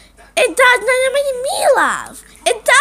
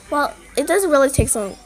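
ordinary talk, nothing marked

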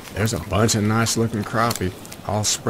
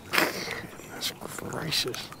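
breathless hushed wonder; whispered amazement, slow; awed whisper; 6 semitones higher, 11 dB quieter, much slower than usual. Real, not acted. Angler staring at a huge crappie, whispering in awe.

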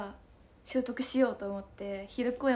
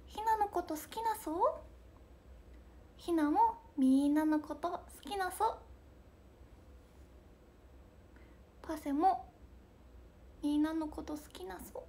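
imitating Hina; 5 semitones higher than usual. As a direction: coo like a cute idol to fans. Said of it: "ひなさんが言う".

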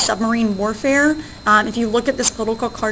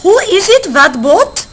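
much higher, louder sailor calling out; louder, higher mock German sailor shouting a challenge; 8 semitones higher, 6 dB louder, slower than usual. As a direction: hail the boat like a suspicious sailor. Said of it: "the German sailor says".

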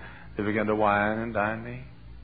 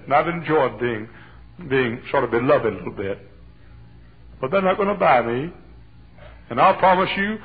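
preaching that gains force, higher and louder; rising sermon; 6 semitones higher, 6 dB louder than usual. Acted. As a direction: build the fire of the sermon. Preaching builds.

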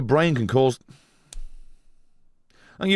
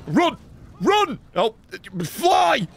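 sharp cries, much higher; panicked yells; 8 semitones higher, much slower than usual. Real, not acted. Streamer reacting to in-game crash, panicked yelling. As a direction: yell it as the car flies.

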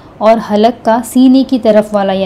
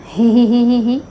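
quiet breathy ही ही like a small laugh; soft giggle he he. Shown as a demonstration. Teacher imitating a light laugh for the ह sound. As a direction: giggle ही ही softly to show the ह. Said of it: "जैसे हम हंसते हैं ना".

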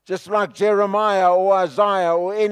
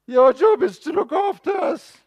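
higher shouted demand to God; shouted repeat; 9 semitones higher than usual. Acted. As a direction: throw it up at heaven, shouting. Prophet repeats, now shouting.

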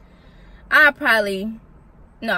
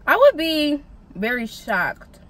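slow, stretched, drawn-out words; slow emphatic shock; 4 semitones higher, slower than usual. Pretend. To viewers, imagining catching her boyfriend, slow stunned emphasis. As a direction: say it slowly, letting the shock sink in.

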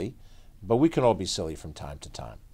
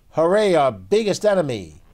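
louder, higher hooray; cheering cavemen; 9 semitones higher, 9 dB louder than usual. Acted. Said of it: "and cheered at what they saw".

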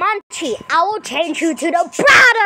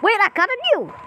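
normal talking voice

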